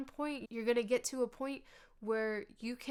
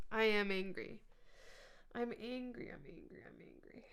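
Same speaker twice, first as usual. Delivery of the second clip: fading to a whisper. Shown as a demonstration. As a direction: say it plainly, then fade to a breathy whisper. Naming anger then letting it fade.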